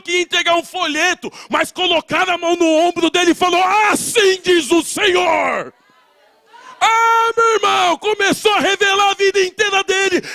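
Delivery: shouting pastor at the climax of the testimony; preaching at full force. Acted. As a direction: preach it to the rafters, building the fire.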